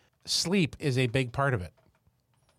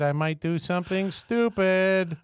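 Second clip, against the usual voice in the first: higher, louder half-joking panic; mock alarmed; 7 semitones higher, 4 dB louder than usual. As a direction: play up the temptation. Quoting own text to friend.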